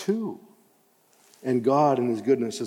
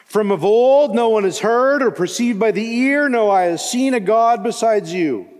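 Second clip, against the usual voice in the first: 1 proclaiming scripture; 9 semitones higher, 6 dB louder than usual. Acted. Preaching scripture at full force.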